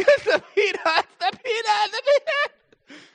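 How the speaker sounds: put-on high, wailing child's sob, stretched out; sobbing through tears. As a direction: blubber it like a six-year-old in tears. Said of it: "through tears".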